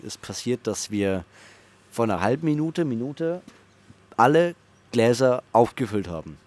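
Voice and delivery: usual speaking voice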